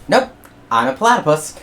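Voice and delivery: loud cheerful Nope; breezy platypus. Acted. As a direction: toss the answer off. Platypus answers.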